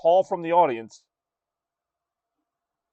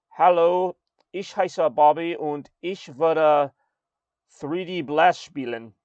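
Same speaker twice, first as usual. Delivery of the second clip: put-on slow, careful German accent; 3 semitones higher, slower than usual. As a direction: read it out slowly in German. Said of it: "I actually did get a text message".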